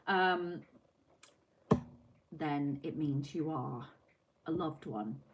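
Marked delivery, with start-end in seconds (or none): none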